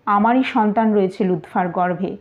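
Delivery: ordinary delivery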